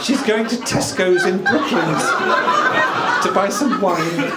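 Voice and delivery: high, loud little dog voice; Pretty the dog's voice. Retold or imitated. Man voices wife's little dog on the phone, high and loud. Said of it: "a voice with inflection and an accent".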